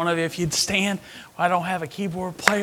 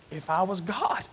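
ordinary talk

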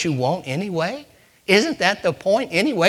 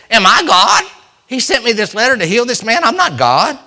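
raised, loud king's protest, sharply higher; alarmed protest; 6 semitones higher, 9 dB louder than usual. Acted. The king of Israel, reading the letter, protests loudly. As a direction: cry out that you are not God.

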